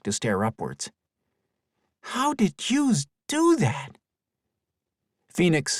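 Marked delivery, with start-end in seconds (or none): 2.0-4.0 put-on very high, slow little boy; a kid gasping in wonder; amazed child voice.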